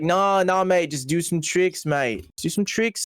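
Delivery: drawn-out imitation of an Australian guy brushing it off; Australian accent. Australian filmmaker waving off their objection, mock Australian accent. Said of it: "like, Australian".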